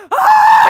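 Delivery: very high loud scream. Retold or imitated. Stunt crew racing past Video Village, scream. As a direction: let out a full scream.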